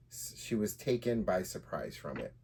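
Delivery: usual speaking voice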